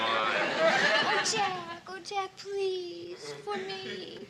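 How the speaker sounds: drawn-out, softening wheedle; coaxing plea. Acted. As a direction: beg sweetly, stretching out the please. Wheedling a favour from roommate.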